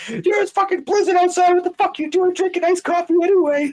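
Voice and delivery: exaggerated coworker tearing into a customer; mock berating rant. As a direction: scold him in a squeaky high mock voice.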